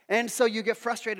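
ordinary delivery

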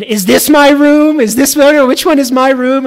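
imitating a three-year-old's excitement; excited child. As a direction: voice the thrilled toddler. Daughter's excitement voiced in story.

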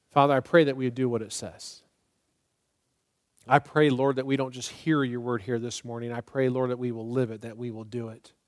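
closing prayer. Acted. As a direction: pray over them gently, trailing off.

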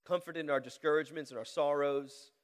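earnest prayer with long pauses, swelling loud on the pleas; public prayer. Real, not acted. Pastor asking the Lord to comfort and challenge his church, earnest prayer. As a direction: plead with God in measured, rising phrases.

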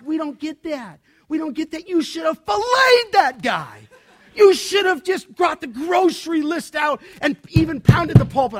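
building to a high, loud, half-crying mock shout; hammed-up outrage, rising to a yell; mock indignant rant. Mock rant at pastor MacArthur.

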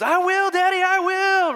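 put-on very high, loud kid squeal; excited young daughter. Daughter promising her daddy, wildly excited. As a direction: burst out like an overjoyed child. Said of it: "she looked at me, she goes".

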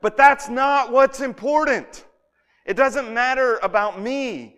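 high, loud, insistent brush-off; emphatic deflection. Retold or imitated. John deflects questions about his authority, emphatically dismissive. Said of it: "he deflects the answer".

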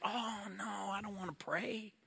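a hint of a dreading kid muttering to himself; mild teenage groan of reluctance; sulky teenage thought. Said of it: "And I thought".

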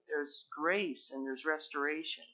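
usual speaking voice

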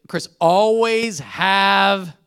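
slow, drawn-out "always" in mom's voice; lightly voiced mom repeating her motto; mom's saying. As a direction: stretch each word like a mother's warning. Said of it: "my mom's saying is".

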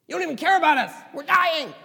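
high, loud frightened shout of sinking men; panicked cry. Retold or imitated. Disciples in the sinking boat, shouting in panic. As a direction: cry out in terror over the storm.

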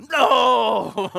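loud anguished no with a laugh; mock protest shout. Preacher acting out refusing God, hammed-up yell. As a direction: cry it out like a dramatic refusal.

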